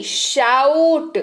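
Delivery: Shout drawn out slowly with a gliding 'ow'; slow spelling-class repetition; slow demo. Shown as a demonstration. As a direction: stretch shout for learners. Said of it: "एस एच ओ यू टी".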